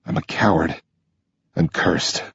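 low, soft broken confession; sad. Acted. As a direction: murmur it through tears. Injured, crying, self-blame.

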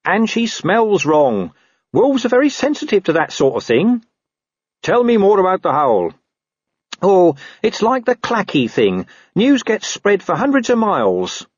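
a hint of a scruffy know-all dog; talking dog. Gaspode tells Carrot about the howl, lightly voiced dog.

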